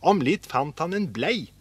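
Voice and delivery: ordinary delivery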